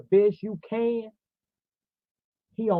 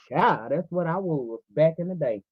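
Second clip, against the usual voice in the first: ordinary talk, nothing marked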